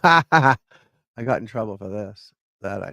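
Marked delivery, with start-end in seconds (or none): 0.0-0.6 laughing. Real, not acted. Laughs at himself.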